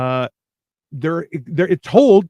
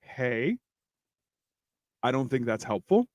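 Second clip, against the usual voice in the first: soothing calm-down voice; 7 semitones lower, 10 dB quieter than usual. Retold or imitated. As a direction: talk him down gently, almost hushed. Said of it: "And I'm like".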